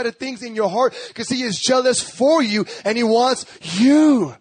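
high, impassioned pulpit delivery; forceful preaching. Real, not acted. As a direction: preach hard, drawing out the key words. Preaches jealous covenant love.

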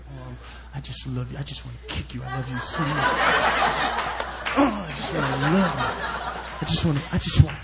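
low, straining voice bursting with affection; hammed-up love, squeezed out as if barely held back; violently loving God. God sitting up there bursting to get his love to you, straining mock-violent affection. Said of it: "how violent God is about getting his love".

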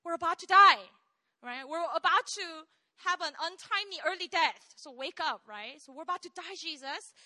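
loud, high alarmed cry; preacher voicing disciples as modern people; mock panic. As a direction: play the panicked disciples for a laugh. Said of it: "what they're saying is".

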